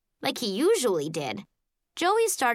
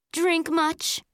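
high, sneering little taunt; snotty; 8 semitones higher than usual. A narrator's reading. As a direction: sneer it like a mean girl. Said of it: "in a snotty voice".

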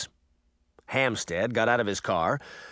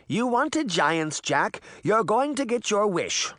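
high, slow grandmotherly character voice; Granny Relda; 8 semitones higher than usual. A narrator's reading. Grandmother to Jack as giants arrive, pointed character voice. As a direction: deliver a pointed warning, pitched up. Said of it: "Granny Relda said".

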